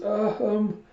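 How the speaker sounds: put-on hesitant child.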